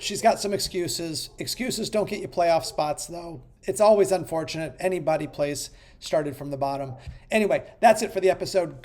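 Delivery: ordinary talk, nothing marked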